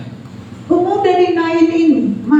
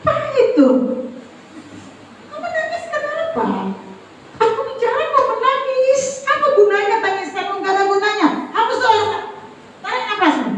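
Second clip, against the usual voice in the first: much higher, exasperated lecturing; retold parental scolding; 8 semitones higher, 3 dB quieter than usual. Preacher re-enacts telling his son to stop crying, exasperated scolding.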